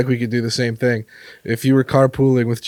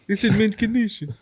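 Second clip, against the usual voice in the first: high, put-on Transylvanian vampire accent; 9 semitones higher than usual. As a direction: purr it like a campy Transylvanian vampire. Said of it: "a vampire voice".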